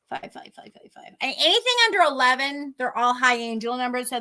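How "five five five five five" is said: very quiet, low repeated mutter; muttering to oneself. Real, not acted. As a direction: murmur it under your breath while searching. Muttering while looking up a number.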